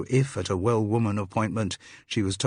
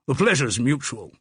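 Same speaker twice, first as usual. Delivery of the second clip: higher, hearty English public school voice; hearty public school accent; 5 semitones higher, 4 dB louder than usual. A narrator's reading. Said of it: "he said in a hearty public school accent".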